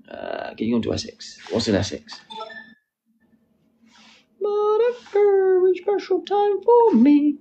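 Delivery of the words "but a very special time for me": very high, slow play-acting voice; high put-on voice. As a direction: draw it out way up high. Repeats catchphrase in put-on high voice.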